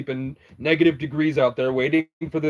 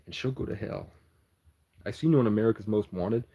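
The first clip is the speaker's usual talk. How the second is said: normal talking voice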